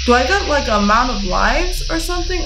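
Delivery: usual speaking voice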